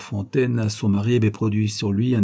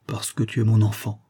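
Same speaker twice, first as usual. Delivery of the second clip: usual speaking voice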